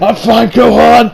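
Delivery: slow, strained-sounding mock reassurance; lightly voiced injured warrior; hurt but stoic. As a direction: act a wounded fighter insisting he's okay. Repeats injured Piccolo with corrected name.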